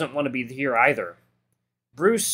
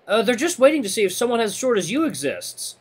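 high, raised ribbing voice; teasing jab; 10 semitones higher, 5 dB louder than usual. A narrator's reading. Friend ribbing Redline about being short, teasing. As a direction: needle your buddy about his size.

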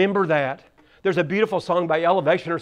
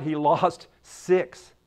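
slow emphatic reveal; 2 semitones lower, 4 dB quieter, much slower than usual. Shown as a demonstration. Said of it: "You know what?".

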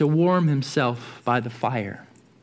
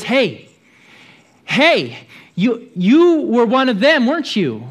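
accusing servant; 7 semitones higher, 6 dB louder than usual. Acted. Servant girl accuses Peter.

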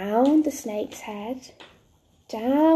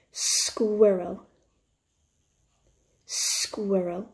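"Squirrel" drawn out with a long initial s; nice and slow; 4 semitones lower, 3 dB quieter, much slower than usual. Shown as a demonstration. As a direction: stretch "Squirrel" slowly, in a lower pitch. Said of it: "say it nice and slow".

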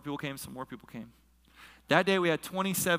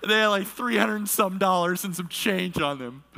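real emotion, pitched up through tears; tearful laughing; 4 semitones higher, 5 dB louder than usual. Real, not acted. Pastor recalling the gift of money, tearful laughing. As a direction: push on through a teary laugh.

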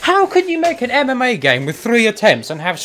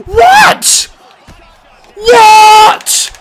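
high, loud disbelieving shout; shocked shout; 13 semitones higher, 10 dB louder than usual. Real, not acted. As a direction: yell it in outrage. Shocked at the game.

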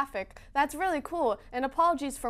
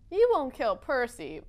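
ordinary talk, nothing marked

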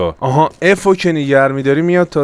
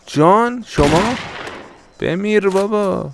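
mock surprise; 9 semitones higher, slower than usual. Answering a caller, then shooting.

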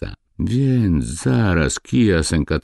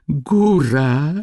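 slightly trembling call; 10 semitones higher, 4 dB louder than usual. A narrator's reading. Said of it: "zawołał podziomek drżącym trochę głosem".

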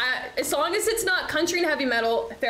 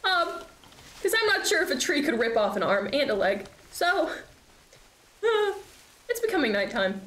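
ordinary talk

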